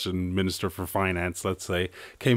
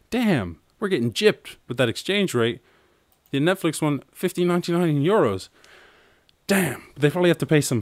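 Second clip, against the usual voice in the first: higher, louder outraged disbelief at the price; indignant surprise; 7 semitones higher, 3 dB louder than usual. Real, not acted. Streamer shocked by the euro price, outraged. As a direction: complain as if robbed.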